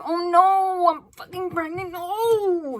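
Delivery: high wailing cry of dismay; hammed-up horror at bad news; mock panic. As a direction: wail it, stretching the last word. Acts out card figure's panicked dismay.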